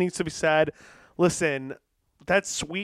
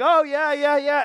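higher, louder, mock-agreeable yeah; polite smiling Brit; 7 semitones higher, 5 dB louder than usual. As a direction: play the smiling Brit hiding his thoughts. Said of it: "the whole time he's polite, he's smiling".

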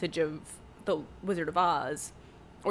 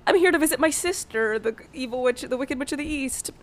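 higher, chipper good witch voice, only lightly put on; a hint of a sweet good witch, pitched up; lightly voiced good witch; 7 semitones higher, 3 dB louder than usual. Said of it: "she's like".

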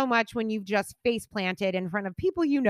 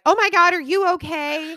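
mock alarmed bystanders, higher and louder; alarmed concern; 9 semitones higher, 9 dB louder than usual. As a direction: play the startled onlookers asking if she's okay. Retold passersby asking concern.

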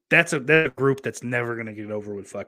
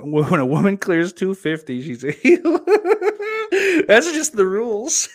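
high, loud voice breaking into laughter; laughing; 9 semitones higher, 8 dB louder than usual. Real, not acted. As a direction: laugh through the words, getting louder and higher. Laughing at a chat joke.

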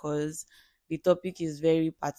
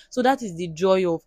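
ordinary delivery

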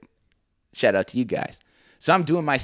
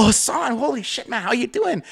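surprised greeting; 9 semitones higher than usual. Retold or imitated. Delighted greeting of long-lost coworker.

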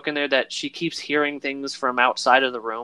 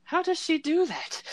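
much higher, softer falsetto wonder; baffled pirates; 4 dB quieter than usual. Retold or imitated. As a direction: ask it amazed and puzzled. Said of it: "And they're like".

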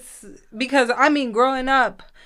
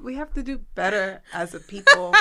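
usual speaking voice

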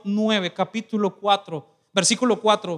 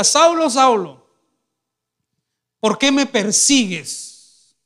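calling voice; 3 semitones higher, 5 dB louder, much slower than usual. Acted. Heavenly voice calling Saul on the road, loud and drawn out. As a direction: call down from heaven, slow and booming.

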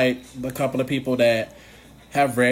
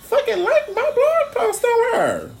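very high, laughing and half-shouting; screeching "shut up" through laughter; retold excited squeal; 4 dB louder, slower than usual. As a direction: shriek with delighted disbelief. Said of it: "I was like".

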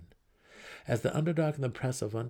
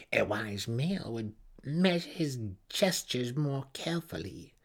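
a mild menacing snarl; snarled threat; 4 semitones higher than usual. Acted. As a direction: warn him, lightly snarled. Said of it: "she snarled aloud".

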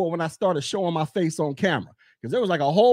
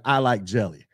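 calm answer; 10 semitones lower than usual. Retold or imitated. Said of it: "The other person could be like".

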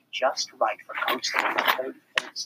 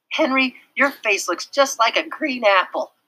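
put-on teasing girl; 10 semitones higher, 5 dB louder than usual. A narrator's reading. Beezus teasing.

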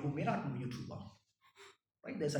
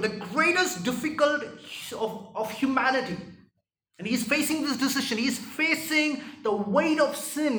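higher, loud, urgent preaching; preaching at full force; 8 semitones higher, 10 dB louder than usual. Real, not acted. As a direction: build the sermon to a passionate peak. Preaching climax on Gethsemane.

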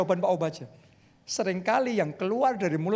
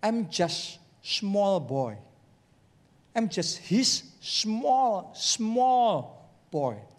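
humble; much slower than usual. Retold or imitated. Pastor Adeboye speaking humbly of himself, very slow. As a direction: say it like a small, humble boy. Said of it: "Dia ngomong".

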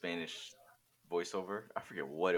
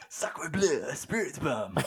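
put-on French accent; 7 semitones higher, much slower than usual. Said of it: "gotta watch anime in, like, French".

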